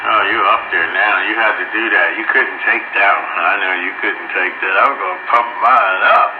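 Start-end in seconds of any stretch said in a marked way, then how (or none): none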